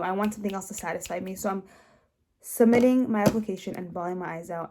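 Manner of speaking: ordinary talk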